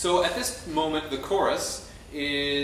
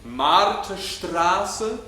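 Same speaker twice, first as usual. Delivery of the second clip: Marterstraße slow, higher and louder, every syllable stressed; Marterstraße leaned on, rising at the end; emphasized word; 6 semitones higher, 6 dB louder, much slower than usual. Shown as a demonstration. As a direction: stretch out Marterstraße with weight.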